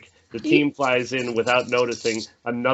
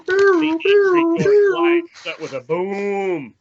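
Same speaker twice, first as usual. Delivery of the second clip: dramatic narration; 5 dB louder, slower than usual. A narrator's reading.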